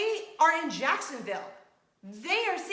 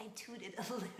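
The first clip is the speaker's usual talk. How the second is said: embarrassed giggle; 4 semitones lower, 13 dB quieter, faster than usual. Real, not acted. Embarrassed confession while laughing.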